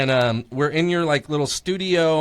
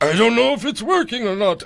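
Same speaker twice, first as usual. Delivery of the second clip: a bit higher, confused; mock unsure; 6 semitones higher, 4 dB louder than usual. Friend unsure of the recorder, lightly higher voice. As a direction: play the dumb unsure friend.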